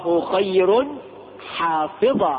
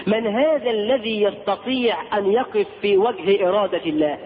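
ordinary talk, nothing marked

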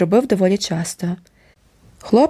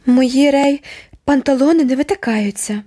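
sharp, higher, offended retort; angry retort; 6 semitones higher than usual. A narrator's reading. Girl answering her aunt, angry and high. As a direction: snap back that they don't stick out. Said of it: "сердито відрубала Дженні".

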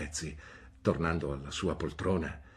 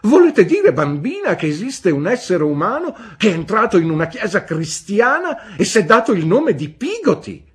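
very high, loud, scandalised; outraged old aunt; 16 dB louder than usual. A narrator's reading. Aunt Betsy scolding the young mother, loud and scandalised. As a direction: sound appalled at the idea.

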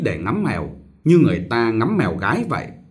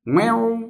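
high startled little yelp; startled child's cry; 11 semitones higher than usual. Acted. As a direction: squeak like a startled toddler. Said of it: "giật mình ấy một tiếng".

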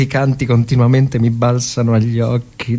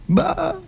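mock explosion; 6 dB quieter than usual. Imitating shellfire.

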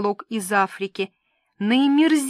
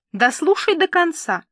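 flaring up; 7 semitones higher, 4 dB louder than usual. Acted. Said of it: "взвилась подруга".

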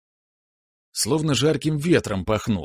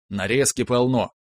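ordinary delivery, nothing marked

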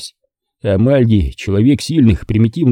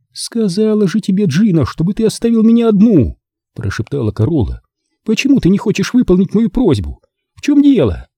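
put-on high young woman, pleading and irritated; молодая женщина Корола; 10 semitones higher than usual. A narrator's reading. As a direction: voice her higher, pressing him to leave. Corola voiced high. (высокий женский голос, с упрёком)

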